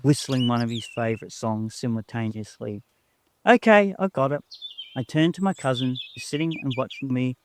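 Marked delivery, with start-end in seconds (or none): 3.5-4.4 high, loud quick reply to grandpa; child's reply. A narrator's reading. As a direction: sing out an obedient answer.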